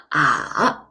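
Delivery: "ah" dipping low, then rising; falling-rising tone. Shown as a demonstration. Teacher showing third tone, "ah" down then up. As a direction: drop "ah" down, then lift it. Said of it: "goes down first and then rises up".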